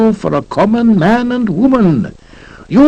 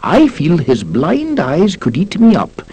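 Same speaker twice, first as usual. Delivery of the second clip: usual speaking voice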